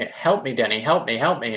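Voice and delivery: usual speaking voice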